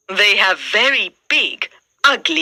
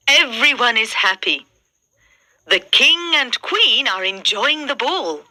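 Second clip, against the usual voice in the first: ordinary delivery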